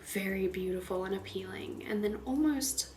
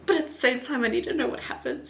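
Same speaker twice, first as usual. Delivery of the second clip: excited; 4 semitones higher, 7 dB louder, faster than usual. Real, not acted. Laughing excitedly.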